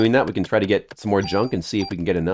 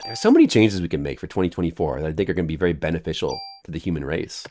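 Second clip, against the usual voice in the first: ordinary talk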